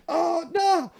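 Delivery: high wailed lament; mock dismay. Fan hearing his idol admit it, mock dismay.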